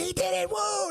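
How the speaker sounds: very high excited woo; cheering. Retold or imitated. Pranksters celebrate the carjack with a high whoop.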